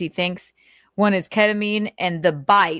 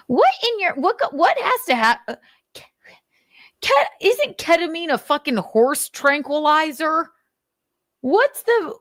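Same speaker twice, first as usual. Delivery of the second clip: high, sputtering disbelief; can't believe what you're reading; stunned disbelief; 10 semitones higher than usual. Real, not acted. Disbelief at ketamine use.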